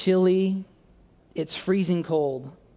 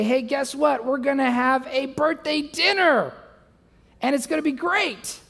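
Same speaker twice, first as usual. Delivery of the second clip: high-pitched, hammed-up big announcement; mock-gleeful, pitched up; acted excitement; 8 semitones higher than usual. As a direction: oversell it like an over-eager husband. Acting excited dinner announcement.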